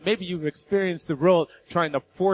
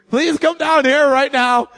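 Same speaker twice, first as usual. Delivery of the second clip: raised, louder urgent call; 7 semitones higher, 4 dB louder than usual. Retold or imitated. Husband calling his wife downstairs, urgent raised call. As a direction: call her down right now.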